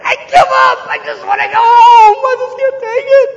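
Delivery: high, loud cry of a racer giving up; desperate yell. Retold or imitated. Racer yelling to teammates they give up, loud high wail. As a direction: wail it like you're quitting, near tears. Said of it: "throws up their hands and yells".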